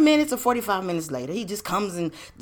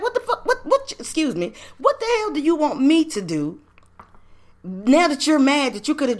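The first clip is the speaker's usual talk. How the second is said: indignant outburst; 6 semitones higher than usual. Real, not acted.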